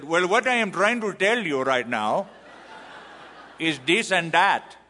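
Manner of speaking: mock Indian English, punched out loud for laughs; imitated Indian accent, playing to the crowd. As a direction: put on a broad Indian accent. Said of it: "I said it with their accent".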